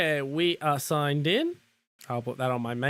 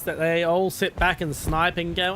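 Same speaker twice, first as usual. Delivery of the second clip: usual speaking voice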